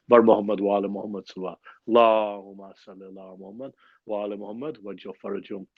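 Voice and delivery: quick chanted call to send blessings; salawat call. Acted. Host calls the gathering to send salawat, chanting. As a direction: rattle off the salawat like a prayer leader.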